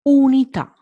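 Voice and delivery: unità, Italian U. Shown as a demonstration. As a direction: say unità with an oo, stressing à. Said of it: "it's not U in Italian".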